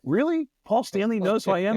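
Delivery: disbelieving surprise. Retold or imitated. Stunned by [name] news.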